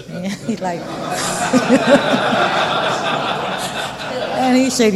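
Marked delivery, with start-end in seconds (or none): 0.8-4.3 long, breathy real laughter. Real, not acted. Speaker recalling partner's confession, long helpless laugh. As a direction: crack up mid-story. Cracks up while telling the story.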